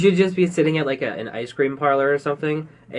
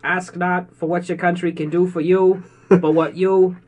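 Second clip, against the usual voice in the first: orate it as JFK, raised and measured; JFK accent; 3 semitones higher than usual. Retold or imitated. Podcaster quoting the inaugural speech, JFK accent. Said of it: "I copied a J F K accent".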